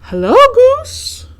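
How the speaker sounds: very high, loud, slow spooky ghost call; hammed-up ghostly greeting. A narrator's reading. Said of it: "the ghost called out".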